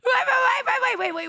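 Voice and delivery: high laughing shouts of wait; excited laughing yell. Real, not acted. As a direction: cut in, laughing loudly.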